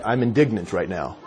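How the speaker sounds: very low, soft mock indignation; really classy. Driver after honking the horn, mock classy indignation. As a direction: drop it low and quiet, absurdly dignified. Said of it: "You don't say really classy".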